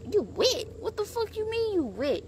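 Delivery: baffled high-pitched disbelief; incredulous. Retold or imitated. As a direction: demand an explanation. Disbelief at her answer.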